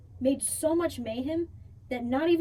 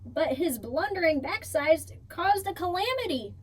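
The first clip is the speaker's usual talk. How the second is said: plain reading voice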